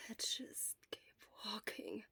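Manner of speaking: slow, breathless whisper; a sick boy whispering he can go on; muttered. Acted. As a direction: barely push the words out, exhausted. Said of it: "he muttered".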